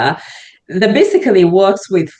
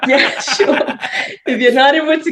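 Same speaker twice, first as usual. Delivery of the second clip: laughing; 10 semitones higher than usual. Real, not acted. Laughs while agreeing to continue.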